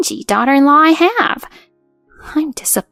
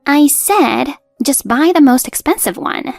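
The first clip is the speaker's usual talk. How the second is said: higher, moderately raised angry repeat; angry insistence; 5 semitones higher, 4 dB louder than usual. Acted. Mother-in-law repeats demand to daughter-in-law, angrily insistent. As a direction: insist with a mild yell. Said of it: "she yelled at me, turning red with anger".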